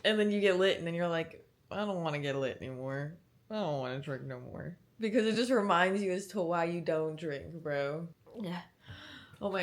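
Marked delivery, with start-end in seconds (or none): 1.7-4.8 low, soft, deflated voice; deflated regret. Acted. Acting out the morning after getting lit, low and deflated. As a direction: sink low and quiet, mock regretful.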